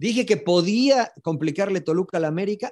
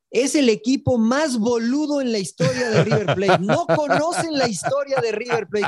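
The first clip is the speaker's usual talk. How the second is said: a higher, slow, laughing fan rant; mock outraged River fan; 6 semitones higher than usual. River fans complain about their own team, slow and higher. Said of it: "los aficionados de River Plate decían".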